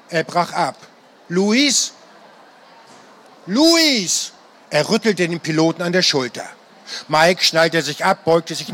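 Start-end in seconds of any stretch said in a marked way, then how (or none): 1.3-1.9 tentative questioning call of his name; a worried, hesitant "Luis?" with a rising tune; uncertain question. A narrator's reading. Unsure call to pilot. 3.5-4.3 "Luis!" called out high and urgent; alarmed cry of "Luis!", pitched high. A narrator's reading. No answer, calls louder.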